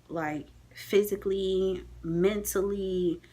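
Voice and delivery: slow, weighted words; slow and worn-out, word by word; drained emphasis. Real, not acted. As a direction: draw each word out wearily. Spelling out how exhausted she was.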